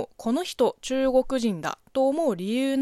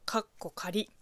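whispered aside; 4 semitones lower, 9 dB quieter than usual. Real, not acted. Host whispering a joke label after the show title, hushed aside.

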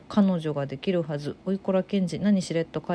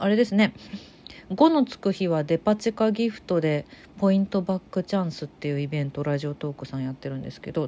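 ordinary delivery

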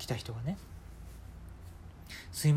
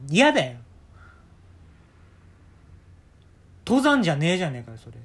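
retort; 2 semitones higher, 8 dB louder than usual. Retold or imitated. Retort to the old man.

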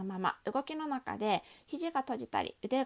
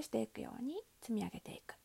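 soft breathy whisper; 2 semitones lower, 6 dB quieter, faster than usual. Real, not acted. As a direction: drop to a whisper. Cueing the roll-up, drops to whisper.

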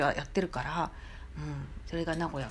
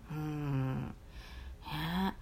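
ordinary talk, nothing marked

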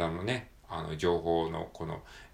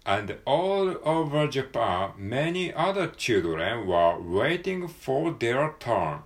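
slow, careful model reading of the line; 4 semitones higher, 4 dB louder, much slower than usual. Shown as a demonstration. Teacher reads the sentence aloud slowly for students, carefully. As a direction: read it slowly as a clear model. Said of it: "アメリカ英語的に".

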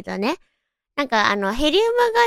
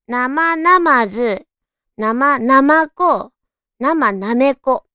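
very slow, syllable by syllable; slow careful tongue twister; 3 dB louder, much slower than usual. Shown as a demonstration. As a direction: spell it out slowly. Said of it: "ん？".

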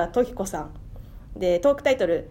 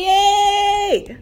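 long drawn-out, high and loud cheer of victory; excited cheer; 11 semitones higher, 6 dB louder, much slower than usual. Real, not acted. Host announcing the result cheers the win, drawn-out and loud. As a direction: stretch the yay out, louder and higher. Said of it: "いや、イエーイ言うてますけど".

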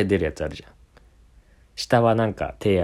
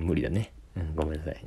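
ordinary talk, nothing marked

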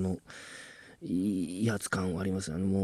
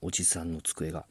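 ordinary talk

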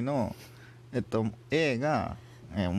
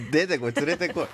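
mock demand; 6 semitones higher, 6 dB louder than usual.

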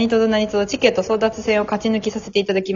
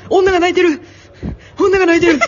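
high-pitched jeering cry; Gunpi impression; 9 semitones higher, 4 dB louder, faster than usual. Retelling the jeer at tearful staff, high mocking shout. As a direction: call it out like a mocking kid, pitched way up. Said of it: "軍備が".

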